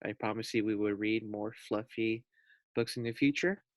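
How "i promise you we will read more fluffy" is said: usual speaking voice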